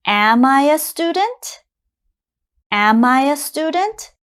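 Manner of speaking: "Am I a student?" climbing steadily to a high end; teacher's clear rising question on "Am I a student?"; question intonation. Shown as a demonstration. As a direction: ask "Am I a student?" with pitch rising right through "student". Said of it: "my intonation goes up for the question".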